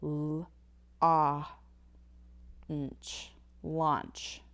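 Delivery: l-, ah, nch stretched into launch; sounded out launch. Shown as a demonstration. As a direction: segment "launch" as l, ah, nch then blend. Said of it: "the word lunch would change to".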